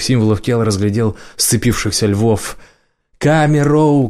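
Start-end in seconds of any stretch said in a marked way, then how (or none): 3.2-4.1 shouting a name from the crowd. Acted. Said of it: "крикнул кто-то". (выкрик из толпы)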